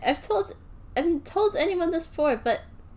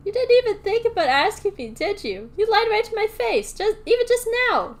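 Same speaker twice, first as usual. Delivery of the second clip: raised, angry voice; shouted; 7 semitones higher, 4 dB louder than usual. Acted. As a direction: accuse him loudly. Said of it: "Drew shouted".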